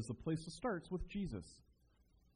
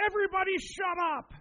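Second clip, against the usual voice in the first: high yelled Everybody shut up, much higher and louder; shouting at the class; 6 dB louder than usual. Retold or imitated. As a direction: yell it like a kid on a desk silencing the class. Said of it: "and she's like".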